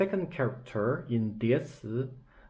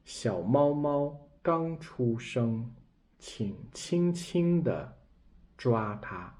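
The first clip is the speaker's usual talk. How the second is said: slow, tender example sentence read to learners; slower than usual. Shown as a demonstration. Teacher reading an example sentence aloud, slow and gentle. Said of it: "We see this example".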